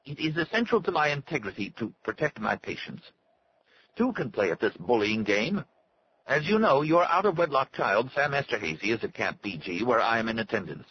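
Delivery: high-pitched, stilted doctor; thin, unnatural voice. A narrator's reading. Doctor warns Arnie to protect his patient, thin unnatural voice. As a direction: threaten in a thin, artificially high voice. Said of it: "in a thin, unnatural voice".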